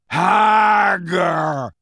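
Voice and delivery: loud, long, high giant bellow; insistent giant shout. A narrator's reading. Grawp searching among centaurs, insistent drawn-out shout. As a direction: shout it insistently, both syllables stretched. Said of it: "he said again, more insistently".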